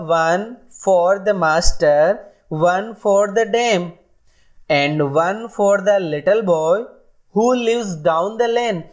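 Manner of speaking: very slow, sing-song nursery rhyme recital; slow rhyme reading. Shown as a demonstration. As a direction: chant it out line by line for children. Reads nursery rhyme to class.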